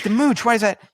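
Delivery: high, loud puzzled echo; puzzled host. Retold or imitated. Host baffled by nickname, high loud echo. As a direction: toss the nickname back, baffled. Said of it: "I'm like".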